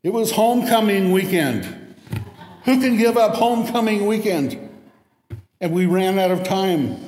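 high, loud whining excuse; whiny excuses. Retold or imitated. Students excusing missed homecoming deadline, whiny. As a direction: whine it like a spoiled student. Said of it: "they begged".

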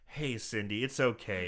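low, hushed mock-soothing voice; gentle reassurance. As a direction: soothe her very softly and low. Others soothe her after outburst.

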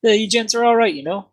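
put-on high-pitched, botched Russian accent; bad Russian accent. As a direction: attempt a Russian villager in a high voice. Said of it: "my best Russian accent".

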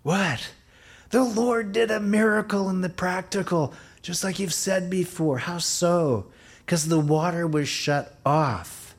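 high, loud excited woman's voice; preacher retelling a sister's excited report. Retold or imitated. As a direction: voice the sister as she breathlessly shares the news. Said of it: "One of our sisters last week said".

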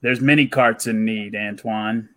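low, secretive stranger voice; lightly voiced shady agent; mysterious recruiter.

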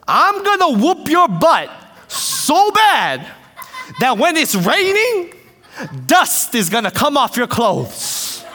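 high, loud, hammed-up old man's threat; playful retold shouting; mock angry threat. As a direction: imitate a furious brawler, pausing between phrases. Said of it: "getting into an altercation with each other".

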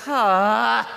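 loud, mock exasperated ah; a groan of divine frustration; hammed-up exasperated sigh. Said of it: "And he goes".

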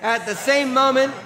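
loud high congregational reading, slowing at the end. Acted. Congregation reading scripture loudly with preacher. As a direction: read it out loud together. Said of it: "read this with me loudly".

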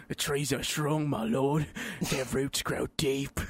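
slow goblin reporting to his master; measured put-on goblin voice; movie goblin. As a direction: imitate a film goblin, drawn out. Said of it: "sounds like my favorite goblin from this movie".

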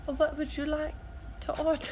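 softly tried French waitress accent; quiet, half-hearted French accent; attempted French accent. Shown as a demonstration. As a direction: take a tentative stab at a French accent. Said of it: "can you do her accent".

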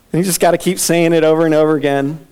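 ordinary talk

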